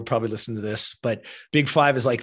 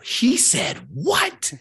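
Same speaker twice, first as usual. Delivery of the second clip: drawn-out, high-pitched indignant mom; outraged disbelief; 12 semitones higher, much slower than usual. Retold or imitated. Mom hearing what coach told her son, outraged disbelief. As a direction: stretch it out and swoop up, appalled.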